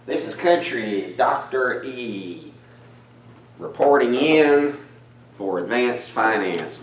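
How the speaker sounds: hearty country howdy to the class; country accent. Acted. Teacher greeting students in a put-on country accent. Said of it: "that country accent".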